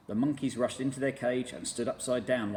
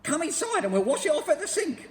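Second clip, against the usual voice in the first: put-on very high, loud, sneering old woman; cackling nasty wife; Missus Twit voice; 9 dB louder than usual. A narrator's reading. Missus Twit sneering at the monkeys, shrill and loud.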